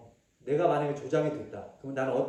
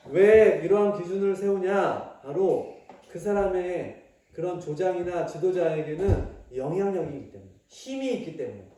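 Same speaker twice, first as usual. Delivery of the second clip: preaching; 4 semitones higher, 8 dB louder than usual. Acted. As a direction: preach it out to the kids. Preaching at full force. (힘 있게 설교하듯)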